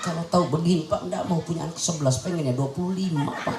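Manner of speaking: low, soft weepy lament; mock tearful regret. Mother telling her husband her regret, soft mock crying. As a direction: act a mother lamenting through tears. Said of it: "Nangis emak kita".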